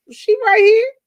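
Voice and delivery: loud high she right here; loud announcement. Retold or imitated. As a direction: hand the phone over loudly. Hands phone over loudly.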